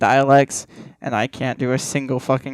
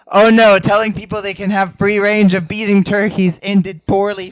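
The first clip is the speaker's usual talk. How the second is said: high, loud mock panic; panicked officials; 6 semitones higher, 7 dB louder than usual. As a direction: gasp out a hammed-up panic.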